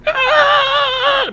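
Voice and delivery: high-pitched mock cry of pain; imitated stifled scream. Patient lifts off the table, imitated pained cry. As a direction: act out jolting with agony. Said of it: "but I don't scream".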